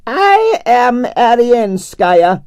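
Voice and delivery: slow, high, grand Russian ballerina voice; proud Russian diva accent, slowed right down; grand Russian announcement. Acted. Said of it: "she announced".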